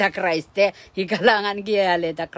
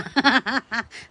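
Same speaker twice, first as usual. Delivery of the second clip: short laugh; 4 semitones higher than usual. Real, not acted. Laugh during sermon.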